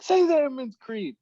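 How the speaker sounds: high, loud mock command; imitated shouted order. Instructor to struggling trainee in gas chamber, mock yell.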